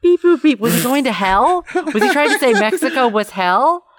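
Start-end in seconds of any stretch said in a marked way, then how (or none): 0.0-0.6 high beep boop robot noises. Retold or imitated. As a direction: chirp out robot beeps. Imitating the robot bit.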